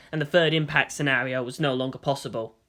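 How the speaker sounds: usual speaking voice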